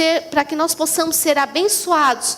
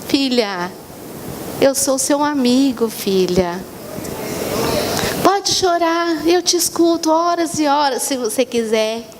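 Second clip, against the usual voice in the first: lower, slow, tender fatherly voice; God tender; 4 semitones lower than usual. Acted. God comforting his weeping daughter, low and tender. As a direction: soothe a crying daughter gently. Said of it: "Aí Deus falou assim".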